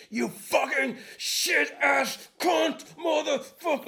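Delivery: hammed-up enraged customer yelling; insanely angry. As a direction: scream it like a raging customer. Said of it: "insanely angry".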